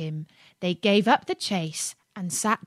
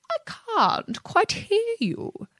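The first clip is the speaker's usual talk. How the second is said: high, slow fox voice; fox feigning deafness; 13 semitones higher, slower than usual. A narrator's reading. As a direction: draw it out high, as if hard of hearing. Sly fox feigns poor hearing.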